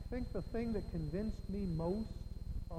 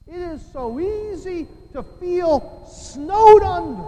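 slow, loud, raised preaching cry; building exclamation; 9 semitones higher, 17 dB louder, slower than usual. Real, not acted. Preacher telling congregation how burdens pile up, loud and drawn out. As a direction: stretch it out and swell to the end.

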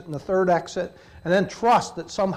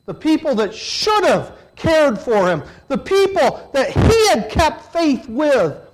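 high, loud, slow lament; impassioned preaching; 7 semitones higher, 6 dB louder, slower than usual. Real, not acted. Preacher to the congregation, loud slow impassioned lament. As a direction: build the betrayal with passion.

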